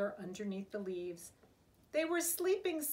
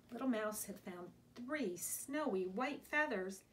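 usual speaking voice

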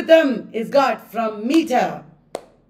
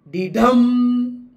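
Didam with light DI, strong long DAM; iambic beat. Shown as a demonstration. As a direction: tap out Didam, weight on the second beat. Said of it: "unstressed followed by stressed".

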